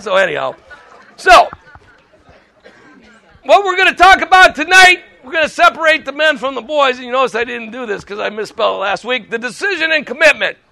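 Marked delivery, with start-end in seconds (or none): none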